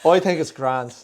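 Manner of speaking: amused put-on Irish; Irish accent. Shown as a demonstration. Boyfriend showing off his Irish accent. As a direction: give it an easy Irish lilt. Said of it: "that was pretty good".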